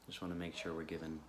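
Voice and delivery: calm, unhurried guidance with long pauses; guided meditation. Real, not acted. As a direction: gently guide listeners into stillness.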